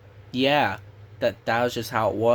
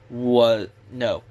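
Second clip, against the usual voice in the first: ordinary talk, nothing marked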